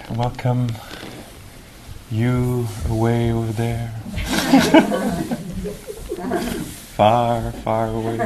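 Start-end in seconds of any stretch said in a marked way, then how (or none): 3.9-6.7 high, giggly laugh; spontaneous high-pitched giggling; real laugh. Real, not acted. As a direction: laugh lightly in a long high peal. Greeting faraway participants, laughs.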